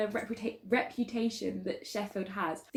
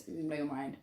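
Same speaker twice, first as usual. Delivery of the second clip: ordinary talk, nothing marked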